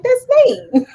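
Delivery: high-pitched little squeal of a reply; mock protest. Panelist answering chatters who don't know him, squeaky mock protest. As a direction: jump way up in mock indignation. Acting own pleading reply to chat.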